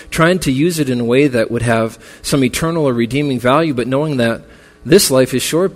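rally the mourners toward eternity's hope; preaching. Acted. Funeral sermon building to preaching.

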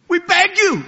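high, urgent begging cry; impassioned begging. Acted. Preacher voicing Paul begging his hearers, impassioned cry. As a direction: cry it out like a desperate plea. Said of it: "You don't find Paul speaking in those terms".

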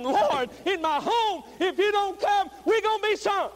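pleading cry that climbs to a high shout; urgent prayer building to a holler; desperation prayer. Acted. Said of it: "a desperation cry and a desperation prayer".

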